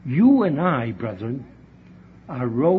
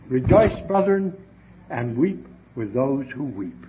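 ordinary talk, nothing marked

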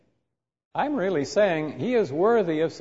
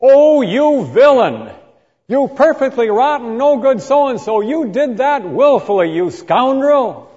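condemning tirade; 8 semitones higher, 9 dB louder than usual. Retold or imitated. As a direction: hurl every insult with full condemnation. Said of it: "That's saying".

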